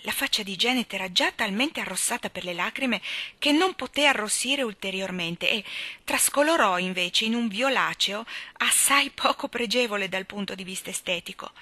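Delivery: plain reading voice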